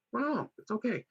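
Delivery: mock sing-song. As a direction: shoo her off in a high patronizing tune. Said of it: "repeat contrasted".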